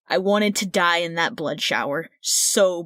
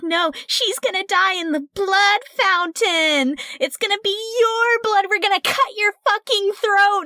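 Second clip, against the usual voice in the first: a much higher, mocking vampire voice; taunting vampire; 12 semitones higher than usual. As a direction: sneer it like a gleeful captor. Vampire taunting the captive player.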